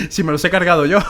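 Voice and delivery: laughing through the words. Real, not acted. Laughing at the guard, since he killed them all himself. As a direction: chuckle while owning up.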